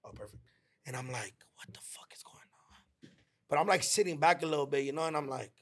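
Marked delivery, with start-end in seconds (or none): 1.5-3.1 whispered under the breath, slow; shocked whisper. Retold or imitated. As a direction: hush it like a private thought of disbelief. Reacting in shock, thinking inside.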